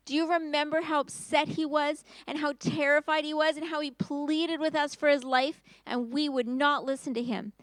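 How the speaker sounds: ordinary delivery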